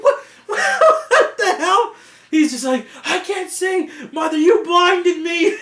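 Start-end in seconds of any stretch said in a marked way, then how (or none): 0.0-1.9 very high, slow, laughing disbelief; stretched, laughing what the hell; shocked what. Retold or imitated. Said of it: "You're just like". 3.0-5.6 imitated furious yell, much higher and louder; hammed-up blind rage, slowed. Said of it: "he gets so furious and worked up".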